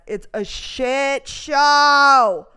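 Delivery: drawn-out, loud exclamation; exasperated outburst. Real, not acted. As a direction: stretch the last words into a loud wail of disgust. Venting about government services.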